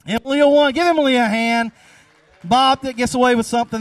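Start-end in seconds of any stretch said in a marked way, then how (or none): 0.2-1.7 excited announcement. Real, not acted. Announcing the winner.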